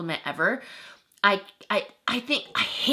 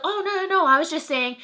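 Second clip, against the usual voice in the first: high-pitched imitation of hasty denial; mocking backpedaler; 8 semitones higher, faster than usual. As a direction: mimic him backtracking, voice pitched way up. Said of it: "in a whole different tone".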